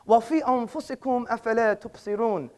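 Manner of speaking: fast, soft Arabic verse; Quran recitation. Acted. Citing the Quran to the hall, quick soft recitation.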